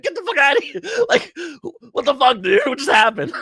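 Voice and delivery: hammed-up shocked disbelief; acted outrage. Co-host voices Americans reacting to Pearl Harbor, mock outraged yelling. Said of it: "We're like".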